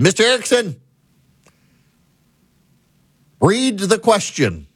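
a hammed-up stern professor summoning a student. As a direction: call him out like a fed-up professor. Angry professor calling on him.

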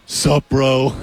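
slow, drawn-out bro greeting; Ryan Hoppy. Retold or imitated. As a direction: imitate a laid-back dude's greeting. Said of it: "I didn't know he talked like that".

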